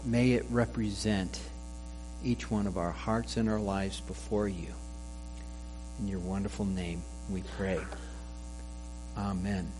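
hushed, reverent prayer close; softly seal the prayer with amen; quiet churchly amen; closing prayer. Acted.

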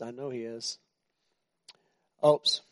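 normal talking voice

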